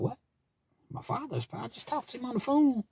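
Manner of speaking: high-pitched, incredulous retort; shocked disbelief. Retold or imitated. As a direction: reenact stunned disbelief, pitch shooting up. Reacting to news of father's death.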